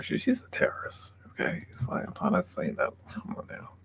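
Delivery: hushed whisper; secretive aside, voice unvoiced; conspiratorial whisper. Real, not acted. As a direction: lean in and whisper it.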